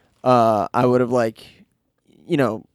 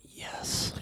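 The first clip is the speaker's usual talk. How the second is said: hushed secret yes; whisper; 16 dB quieter than usual. Retold or imitated. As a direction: whisper it under your breath. Gleeful retold whispered answer.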